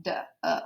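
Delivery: "duh, uh" whispered as a short unstressed uh; whispered schwa sound "duh, uh"; schwa demo. Shown as a demonstration. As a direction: breathe out a quick unstressed "uh". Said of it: "this E is that schwa sound".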